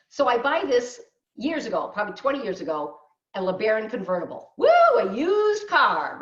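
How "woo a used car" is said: high, loud, drawn-out whoop of mock excitement; excited whoop. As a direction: whoop it up over the car. Mock fanfare over old car purchase.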